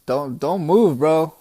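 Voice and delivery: lightly played Western standoff warning; old Western style. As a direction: play at holding a hostage at gunpoint. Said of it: "old Western style says".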